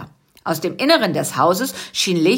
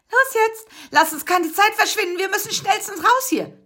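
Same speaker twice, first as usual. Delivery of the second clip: urging; 14 semitones higher than usual. Acted. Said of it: "drängte Busy".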